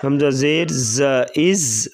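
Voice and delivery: slow, measured spelling-out of each letter; qaida drill recitation. Shown as a demonstration. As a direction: chant the syllables as a teacher drilling children.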